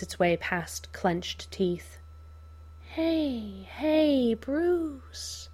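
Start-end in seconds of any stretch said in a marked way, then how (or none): 2.8-5.5 high, gentle Joker voice; soothing. Acted. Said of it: "Joker soothes".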